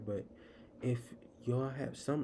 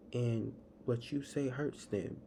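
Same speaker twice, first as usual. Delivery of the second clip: ordinary talk, nothing marked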